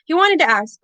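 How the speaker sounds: plain reading voice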